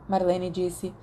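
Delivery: ordinary delivery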